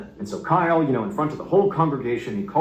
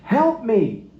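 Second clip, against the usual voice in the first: high-pitched cry for help, a little louder; narrated screaming for help; 11 semitones higher, 3 dB louder, much slower than usual. A narrator's reading. Pastor crying to his congregation for help, restrained high-pitched scream. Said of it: "He began screaming".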